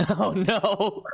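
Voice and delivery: high laughing oh no. Real, not acted. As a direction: laugh at the bad luck. GM laughs at bad roll.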